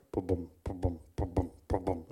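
deep, soft thumping heartbeat bum bum; low heartbeat thuds sounded out in the mouth; heartbeat noise. Retold or imitated. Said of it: "his heart is going like mad".

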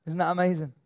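usual speaking voice

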